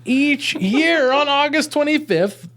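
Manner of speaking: ordinary delivery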